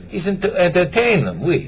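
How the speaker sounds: put-on French accent. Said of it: "start over with an accent".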